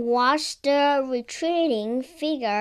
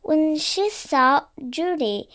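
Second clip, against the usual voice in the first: ordinary delivery, nothing marked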